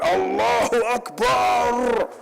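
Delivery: high, slow, drawn-out mock shout of Allahu Akbar; a mocking battle cry. Mock jihadist shout in story.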